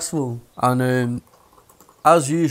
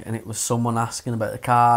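ordinary talk, nothing marked

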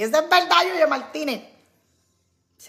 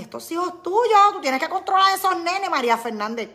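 bossy friend; 7 semitones higher, 8 dB louder than usual. Acted. Voicing a critical friend.

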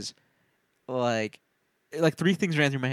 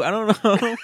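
giggling through the words; amused mumble; laughing; 6 semitones higher, 4 dB louder, slower than usual. Real, not acted. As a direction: let the laugh spill into it. Said amid laughter.

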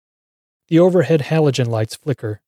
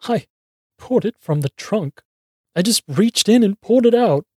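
high, broken, sobbing plea; choking sobs; 9 semitones higher than usual. A narrator's reading. Beaten man answering Mac, choking sobs. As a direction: choke out the answer in pieces. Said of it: "I answer between choking sobs".

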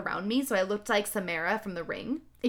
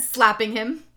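plain reading voice

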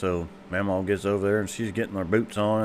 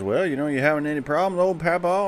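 doctor voice; 7 semitones higher than usual. Acted.

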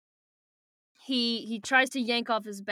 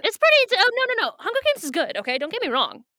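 high, loud backtracking; emphatic, pitched-up defence; hasty protest; 10 semitones higher, 7 dB louder, faster than usual. Real, not acted. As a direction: jump in loudly to correct yourself. Hastily defending Hunger Games.

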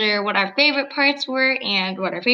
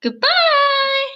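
cheery goodbye; 4 dB louder than usual. Real, not acted. Cheery sign-off.

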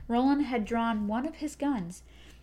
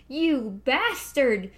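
loud, slow, raging cry; screamed curse; 2 semitones higher, 6 dB louder, much slower than usual. A narrator's reading. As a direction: hurl it out in grief and rage. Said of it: "screamed a woman's voice".

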